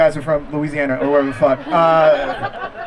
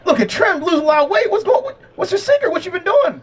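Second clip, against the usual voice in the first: higher, eager gym-bro voice rising as it goes; lightly voiced curious gym buddy; 10 semitones higher than usual. Gym buddy asking the comic his weight-loss secret, eager gym-bro voice. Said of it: "brother man gonna come up to me and be like".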